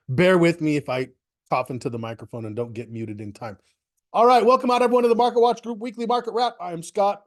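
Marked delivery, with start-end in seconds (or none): 4.1-7.2 upbeat host welcome; bright, fast show opener; announcer voice. Acted.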